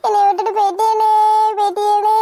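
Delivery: hammed-up fake sobbing lament; mock wailing. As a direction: pretend to bawl, teasing the one left behind. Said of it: "நானும் உங்க கூட சேர்ந்து உட்காந்து அழுகுறேன்".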